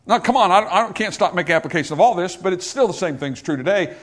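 usual speaking voice

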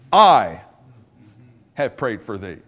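"I" loud and set apart by a long pause; stress on I. Shown as a demonstration. Preacher showing who is praying in the verse, stress on "I". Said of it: "in that verse is emphatic".

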